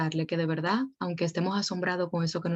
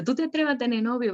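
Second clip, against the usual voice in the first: higher, a hammed-up indignant challenge; mock outraged parent; 7 semitones higher than usual. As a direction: scold the daughter in disbelief. Parent reacting to daughter's news. (madre indignada imitada, en tono alto)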